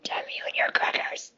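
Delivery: whispered curse. Real, not acted. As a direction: hiss it under your breath. Hungry jealous curse.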